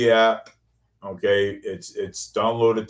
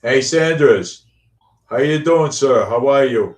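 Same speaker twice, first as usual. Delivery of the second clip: loud greeting; 4 semitones higher, 6 dB louder than usual. Real, not acted. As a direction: call out a big hello. Greeting into the robot recorder.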